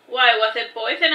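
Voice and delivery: ordinary delivery, nothing marked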